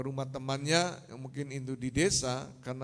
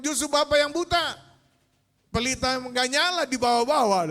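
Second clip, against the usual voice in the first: high, raised-voice comeback; indignant retort; 8 semitones higher, 6 dB louder than usual. Acted. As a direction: throw the blame back, pitched high. Bumped man retorts in quarrel. (membantah kesal dengan nada tinggi)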